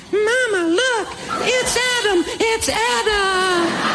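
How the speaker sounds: put-on high, drawn-out child's holler to mama; excited little kid. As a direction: imitate a little kid calling out slowly, way up high. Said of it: "Little kids are going".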